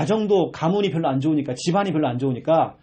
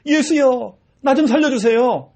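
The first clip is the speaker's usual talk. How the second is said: high, loud pleading cry; desperate plea for rescue; 7 semitones higher, 4 dB louder, slower than usual. Retold or imitated. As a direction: beg loudly like the blind man. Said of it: "오늘 바디메오처럼".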